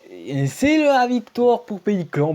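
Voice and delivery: high, loud, drawn-out cheer; stretched triumphant shout; victory announcement. Real, not acted. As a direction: announce the win like an arena commentator. Announces the win.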